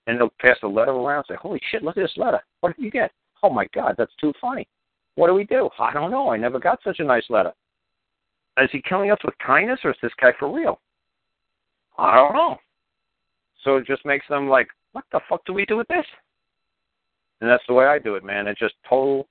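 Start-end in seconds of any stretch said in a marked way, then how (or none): 1.4-12.6 high, giddy office chatter, lightly voiced; stunned coworkers gossiping in a high voice; flustered agents. Imitates agents passing letter around. 14.9-16.0 squeaky high mock panic; confused clerk, voice jumped up high; baffled agent.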